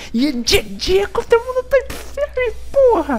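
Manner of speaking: screaming high with disbelief; shrieking jackpot winner. Real, not acted. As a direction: shriek the win, voice cracking. Winning an impossible game.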